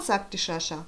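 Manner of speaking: ordinary delivery, nothing marked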